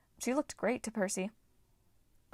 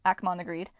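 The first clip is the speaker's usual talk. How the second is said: usual speaking voice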